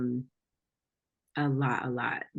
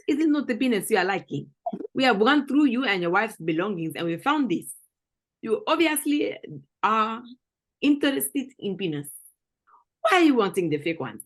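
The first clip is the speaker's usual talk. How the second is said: higher-pitched mock African accent; African interrogator, accusing and incredulous; 5 semitones higher than usual. Confronting someone over found belongings, mock African accent.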